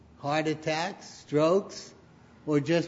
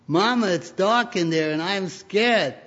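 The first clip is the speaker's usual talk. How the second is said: put-on raised, louder frightened child's plea; scared little boy; 5 semitones higher, 5 dB louder than usual. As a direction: plead like a little boy afraid of the dark. Scared boy tells his mother.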